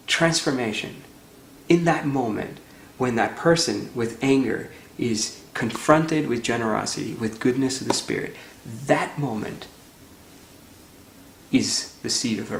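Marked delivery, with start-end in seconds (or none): none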